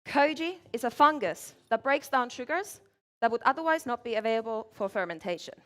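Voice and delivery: usual speaking voice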